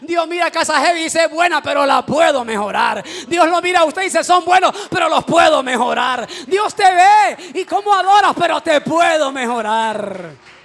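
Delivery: preaching at full force. Acted. Pastor to his church, preaching at full force, loud and high. Preaching climax on God improving.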